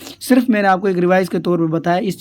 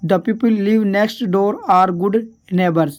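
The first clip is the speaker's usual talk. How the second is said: slow dictation; much slower than usual. Shown as a demonstration. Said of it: "slow read of example sentence".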